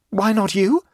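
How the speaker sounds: high, demanding question. A narrator's reading. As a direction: demand an answer. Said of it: "demanded Abbleway".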